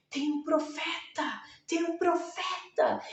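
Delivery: mais agudo, exclamando com espanto; multidão maravilhada. Pretend. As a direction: anuncie o milagre maravilhado. (multidão maravilhada anuncia o profeta, voz aguda)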